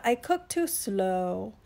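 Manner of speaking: sluggish, drawn-out turtle voice; slow down and stretch the last word; unhurried turtle drawl. Acted.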